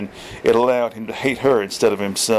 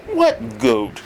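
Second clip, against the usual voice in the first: surprised. A narrator's reading. Dean's surprised question.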